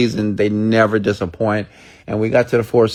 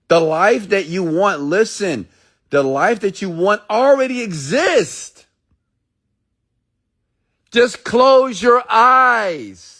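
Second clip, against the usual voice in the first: much higher, slow and drawn out; intense exhortation; 11 semitones higher, slower than usual. Acted. Speaker urges listeners to close their eyes, high and intense. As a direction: lift them up, pleading them to close their eyes.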